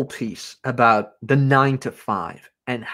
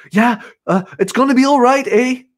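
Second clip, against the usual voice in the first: high-pitched imitation of an anxious trainee; seeking reassurance; 9 semitones higher, 3 dB louder than usual. Nervous coworker begging Jack to confirm, anxious and high. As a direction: plead for confirmation in a mock high voice. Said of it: "she was keep asking me to confirm".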